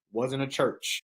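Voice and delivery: ordinary talk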